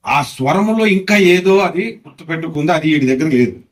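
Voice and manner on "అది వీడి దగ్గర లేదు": usual speaking voice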